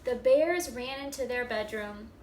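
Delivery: animated storybook reading. A narrator's reading. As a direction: act it out for the first graders. Reading picture book aloud.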